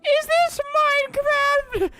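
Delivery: very high, drawn-out question; mock an excited newbie in a slow, very high voice; put-on squeaky player voice.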